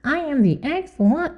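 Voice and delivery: high put-on haughty voice; self-important ex-wife. Host mocking how the ex-wife announced herself, self-important. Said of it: "you have to say it like that".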